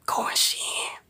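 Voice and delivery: unvoiced secret whisper. To the ladies in the stream, a secret whisper. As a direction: lean in and whisper it like gossip. Secret nail salon price.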